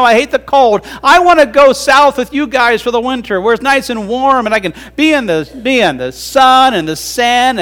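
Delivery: high, whining turtle complaining about winter; whiny turtle. Acted. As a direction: moan like a turtle who hates the cold. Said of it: "he told his bird friends".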